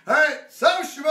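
fast, loud shout to the crowd; rally the room with a quick shout; rowdy call-out. Real, not acted. Calls out for a drinking song.

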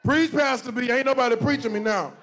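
ordinary delivery

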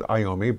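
Iommi said as eye-OH-mee; long I opening Iommi; eye-OH-mee pronunciation. Shown as a demonstration. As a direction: start Iommi with the word "eye". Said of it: "I would pronounce it".

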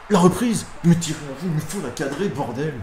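exclamation haute qui retombe en juron; cri de frustration. Real, not acted. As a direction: pester contre le tir raté, fort puis moins fort. Occasion ratée, il peste. (râler vivement)

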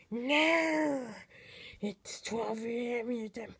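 odd put-on alien-like villain voice; purge guy on TV. Guy on the TV announcing the hour, put-on villain voice. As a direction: draw out the "no" in a strange voice. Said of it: "So you're the guy on the T V".

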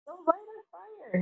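choking. A narrator's reading. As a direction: squeeze the words out while being strangled. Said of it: "Harry choked".

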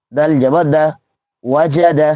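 ordinary delivery

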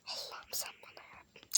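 slow whispered plea, fed up; exasperated whisper. Pretend. Traveler begging God for help with luggage, exasperated whisper. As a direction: whisper slowly as if patience is running out.

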